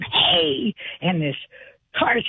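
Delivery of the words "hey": a mild mock yell at a speeding car; yelled hey. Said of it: "and I yelled".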